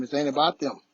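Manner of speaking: ordinary talk, nothing marked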